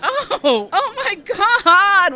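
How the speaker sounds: high, loud cry of alarm; shocked shriek. Acted. Patty jolted by Jenny's dropped glass, shrieking.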